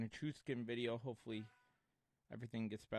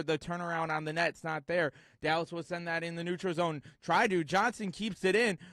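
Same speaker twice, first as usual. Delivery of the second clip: lively hockey play-by-play call; 6 semitones higher, 7 dB louder than usual. Acted. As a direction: call the game live and fast.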